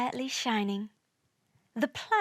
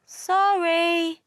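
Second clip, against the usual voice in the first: higher, drawn-out put-on voice; sheepish apology; 6 semitones higher, 4 dB louder than usual. A narrator's reading. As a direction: apologise like told-off children. Scolded planets apologising together.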